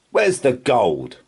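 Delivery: slow, loud demanding shout; angry shout. A narrator's reading. Pirate chief demanding the gold from the Troll, slow angry shout. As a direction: bark it out at the Troll. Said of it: "shouted Hank Chief".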